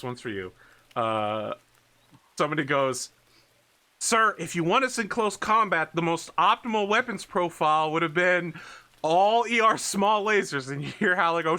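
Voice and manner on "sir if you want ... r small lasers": higher, louder lecturing voice; know-it-all subordinate. Acted. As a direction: advise the commander at length. Subordinate lecturing the commander over radio.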